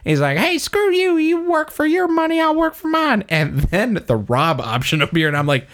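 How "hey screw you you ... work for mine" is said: put-on defiant old panner. Voicing defiant NPC panner.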